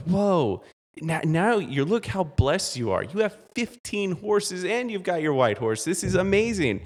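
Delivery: high, awed gush over the horses; amazed villagers. Retold or imitated. Villagers marvel at the old man's fifteen horses, high amazed voice.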